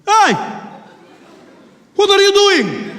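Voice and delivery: very high, loud challenge; confronting shout. Acted. Passenger opposite challenges the bomber, shouting. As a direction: call him out, drawing out the words.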